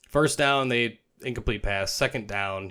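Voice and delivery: ordinary talk, nothing marked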